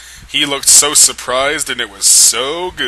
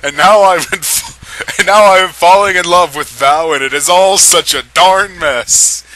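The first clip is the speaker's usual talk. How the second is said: higher and louder, laugh-strained; barely holding the giggles back; suppressed laughter; 7 semitones higher, 8 dB louder than usual. Real, not acted. Narrator fighting laughter retrying Edward's line. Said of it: "I'm trying not to laugh so hard".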